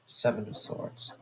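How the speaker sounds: soft whispered aside to herself; whispered note. Real, not acted. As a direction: whisper the card name as a note. Noting a card to herself.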